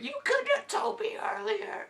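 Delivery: extremely high, loud squeaky imitation; squeaky mock complaint. Stranger complaining to the dad, in a squeaky mock voice. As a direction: squeak it out in a put-on voice.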